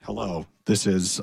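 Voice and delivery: lightly voiced cowboy accent dropping low as if a knob was turned; cowboy accent knob twisted. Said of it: "it turns out that's my accent knob".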